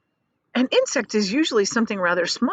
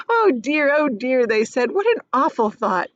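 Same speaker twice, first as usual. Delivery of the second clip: higher and louder; amused creatures; 6 semitones higher, 4 dB louder than usual. Acted. Creatures amused that James fears being eaten, higher. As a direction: chuckle it out in chorus. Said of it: "a roar of laughter".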